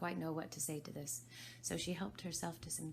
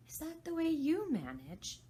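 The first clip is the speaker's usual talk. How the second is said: put-on high, light girl's voice; a polite little girl asking; Alice voice; 12 semitones higher, 7 dB louder than usual. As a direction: ask it as young curious Alice.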